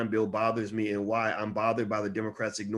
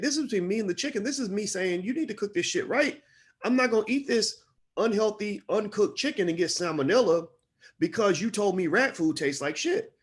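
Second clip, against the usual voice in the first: high, heated protest in a raised pitch; exasperated outburst; 9 semitones higher than usual. Retold or imitated. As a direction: pitch it up and push back, fed up. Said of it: "And I'm like".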